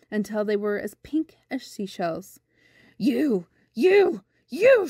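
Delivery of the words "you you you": rising, louder burst of outrage; angry cry. A narrator's reading. Great aunt to naughty boy late for supper, angry cry. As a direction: cry it out angrily, climbing higher each time. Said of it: "she cried".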